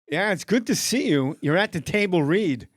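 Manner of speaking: loud, high, odd-cadenced star impersonation; Christopher Walken. Acted. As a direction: greet the room as the impersonated actor. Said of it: "you're gonna do Christopher Walken".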